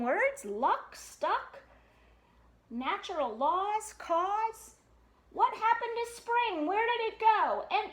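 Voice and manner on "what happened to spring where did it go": ordinary delivery, nothing marked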